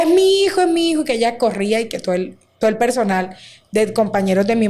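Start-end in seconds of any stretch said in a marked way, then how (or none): 0.0-1.0 higher, louder cry of a desperate mother; mother's retold outcry, pitched high and loud; anguished cry. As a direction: cry it out as a mother who has just seen her son. Mother running, crying out for son.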